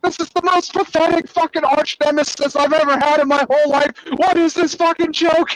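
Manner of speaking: high-pitched, incredulous mock rant; outraged villain. Villain complaining about his pathetic arch nemesis, outraged. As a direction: imitate a cartoon villain's disgusted outburst, voice way up.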